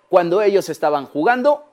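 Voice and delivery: ordinary delivery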